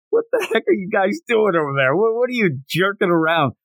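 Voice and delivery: a hint of a grumpy father calling over; irritated dad. Dad scolding the kids, mildly irritated. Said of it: "the dad seemed so irritated".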